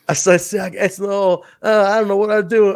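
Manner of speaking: mock Siaki's weird talk; imitating Sanders' Siaki impression. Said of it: "impersonating Sonny Siaki".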